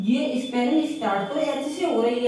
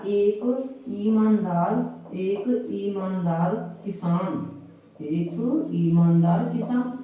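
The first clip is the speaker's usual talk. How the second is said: एक ईमानदार किसान drawn out slowly; slow example read; much slower than usual. Shown as a demonstration. Teacher dictating an example to the class, slow. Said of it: "दूसरा example है ये".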